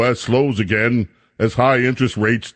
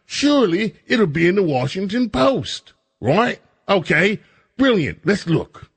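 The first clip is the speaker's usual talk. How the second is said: sarcastic; 6 semitones higher than usual. Real, not acted. Sarcastic hope about the Post.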